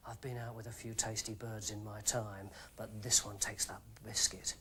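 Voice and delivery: very low, very soft aside. A narrator's reading. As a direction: keep it close and intimate. David's smooth inner thoughts.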